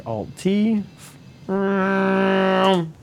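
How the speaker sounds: ordinary talk, nothing marked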